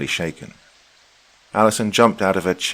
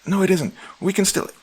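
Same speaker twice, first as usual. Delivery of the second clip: frantic protest. Acted. Wife protesting before being cut off.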